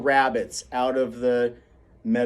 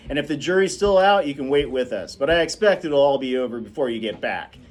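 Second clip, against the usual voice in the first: louder, indulgent father's voice; relenting Atticus; 3 semitones higher, 5 dB louder than usual. Acted. Atticus giving in and letting his children come back. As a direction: give in kindly but firmly.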